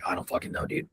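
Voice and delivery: half-whispered, dropped low and soft; a soft shrugging mutter; muttered aside. Retold or imitated. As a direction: toss it off under your breath. Retelling his reply to struggling kid.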